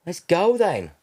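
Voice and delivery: high, loud prompt to get moving; retold urging. Retold urging of hesitant trainee midwife.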